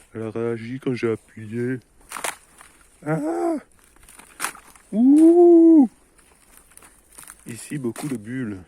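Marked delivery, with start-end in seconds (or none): 0.1-1.8 louder, thrilled; excited repeat. Real, not acted. Gamer thrilled the bubble reacted, louder. 3.1-5.9 very high, very loud shriek; startled shriek. Real, not acted. Gamer shrieking as bubbles burst, startled.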